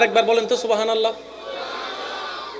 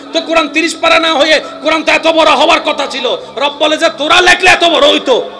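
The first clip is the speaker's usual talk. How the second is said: high, loud sermon voice, building to a shouted সুবহানাল্লাহ; impassioned preacher; full-force preaching; 6 semitones higher, 4 dB louder than usual. Acted. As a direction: preach it out to the whole crowd.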